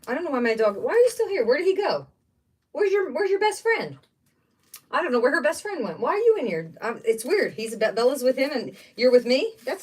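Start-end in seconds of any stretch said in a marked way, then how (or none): 0.8-6.7 high, sing-song pet talk. Real, not acted. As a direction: coo at the dog. Talking to her dog.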